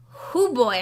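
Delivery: usual speaking voice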